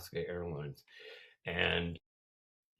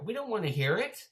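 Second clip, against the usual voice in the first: loud, high chorus-style complaint; raised, high-pitched heckle; mock crowd protest; 13 semitones higher, 6 dB louder, faster than usual. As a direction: imitate annoyed passengers calling out. Imitating crowd of passengers.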